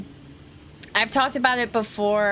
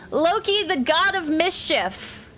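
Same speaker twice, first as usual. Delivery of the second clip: dramatic announcer; 7 semitones higher than usual. Acted.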